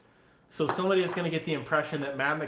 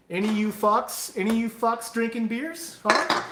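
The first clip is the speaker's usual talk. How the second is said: calling out to chat; 7 semitones higher, 6 dB louder than usual. Real, not acted.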